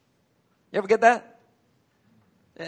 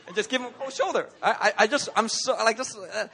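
ordinary talk, nothing marked

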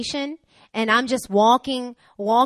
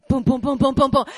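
loud, even booming beats; imitated stomping; 3 semitones higher, 7 dB louder than usual. Woman marching back up those stairs, loud stomping noises. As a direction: pound out each boom like a footstep.

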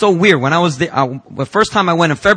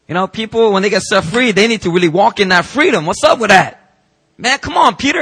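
higher and louder, throwing up his hands in frustration; exasperated rant; 7 semitones higher, 5 dB louder than usual. Retold or imitated. Pastor venting to God about a relapsed young man, exasperated. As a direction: complain out loud, exasperated.

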